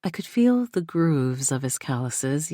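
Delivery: plain reading voice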